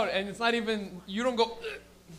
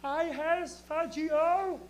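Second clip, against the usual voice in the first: put-on slow baby talk; 8 semitones higher, much slower than usual. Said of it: "You can has".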